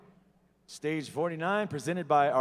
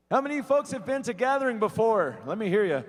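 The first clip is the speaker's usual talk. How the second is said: crowd call-out; 6 semitones higher, faster than usual. Real, not acted. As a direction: pump up the audience. Emcee rallying the crowd.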